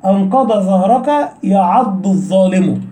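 slow, with ض and ظ kept clearly apart in "أنقض ظهرك"; careful letter contrast. Shown as a demonstration. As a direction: say "أنقض ظهرك يعض الظالم" slowly and separate the ض from the ظ. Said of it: "وضرب أمثلة".

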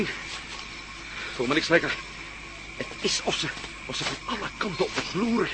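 whispered, nervous confession; uneasy whisper. Acted. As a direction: breathe it out, spooked by the dark. Uneasy in dark jungle.

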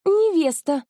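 high-pitched, assured claim; staking a claim as fiancée; confident declaration. A narrator's reading. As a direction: state it proudly, pitched well up. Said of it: "уверенно заявила Лера".

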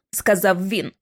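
ordinary delivery

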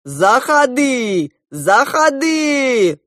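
put-on loud, drawn-out Georgian-accented shout; booming Georgian-accented welcome; strong Georgian accent. A narrator's reading. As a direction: call out high and long in a Georgian accent. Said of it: "закричал неожиданно сильным грузинским акцентом".

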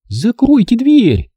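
high, slow, cross cry; angry shout. A narrator's reading. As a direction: yell at them to shut it. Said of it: "сердито крикнула она".

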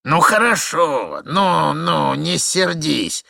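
put-on lilting, lingering old man's soothing; singsong old man. A narrator's reading. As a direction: placate in drawn-out singsong. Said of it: "возразил старичок нараспев".

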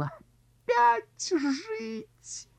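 very high, slow anguished moan. Acted. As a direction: moan in despair at living. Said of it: "он простонал".

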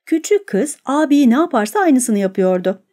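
ordinary delivery

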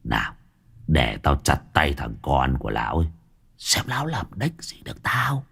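a swaggering village thug boss, scornful; lightly voiced boss, sneering at old Tú. Gang boss mocking old Tú to his underlings, scornful. Long threatens to chop hand.

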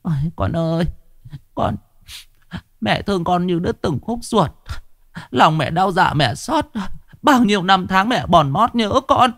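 high, keening mother calling to her dead son; mother lamenting at the incense for her son; grieving lament. Acted. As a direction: pour out a mother's sorrow in a sing-song wail. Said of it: "lẩm bẩm".

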